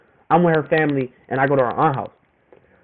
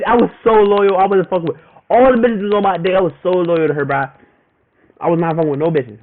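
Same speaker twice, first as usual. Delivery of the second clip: usual speaking voice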